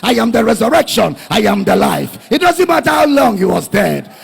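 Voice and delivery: loud, rising proclamation; preaching at full force. Acted. Preacher booms Jesus' words to Mary. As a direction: thunder each claim at the crowd.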